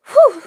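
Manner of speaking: high, loud whew; heavy exhale. Real, not acted. Streamer pulling herself together on a livestream, loud exhale. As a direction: blow out a big steadying breath. Collecting herself after crying.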